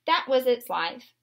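ordinary delivery, nothing marked

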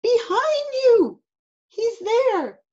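put-on high, loud child's alarm; high-pitched panicky cry; screamed warning. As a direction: warn Mom urgently in a small boy's voice. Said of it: "screamed Eddie".

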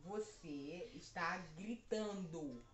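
word by word, very slowly; slow enunciation. Real, not acted. Son telling mum in headphones she's shouting, slowly enunciated. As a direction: spell it out for a lip-reader.

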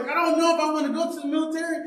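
high, fast fretting voice; hammed-up worried young man; mock reluctant recruit. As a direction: rush it out high like a nervous kid.

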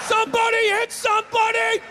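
high, loud game-day shout; fired-up football shout. Retold or imitated. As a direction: scream it like a pumped-up linebacker. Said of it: "walking through the tunnel at the Rose Bowl".

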